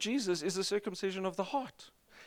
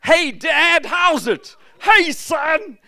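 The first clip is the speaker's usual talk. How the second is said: loud, high, breezy greeting; casual chat with Dad; 12 semitones higher, 12 dB louder, much slower than usual. Acted. A child greeting his Dad God, loud and breezy. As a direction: greet God like your father.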